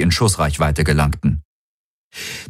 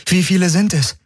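anxious question; 11 semitones higher than usual. A narrator's reading. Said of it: "fragte Kirai".

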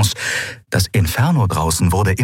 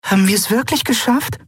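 hohe, vorsichtige Stimme; zaghaft, kaum zu hoffen wagend; vorsichtig; 14 semitones higher than usual. Acted. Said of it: "fragte Nelen vorsichtig". (Nelen fragt vorsichtig)

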